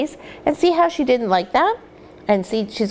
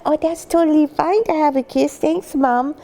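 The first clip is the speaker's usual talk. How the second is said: puppy voice; 6 semitones higher than usual. Pretend. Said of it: "She said".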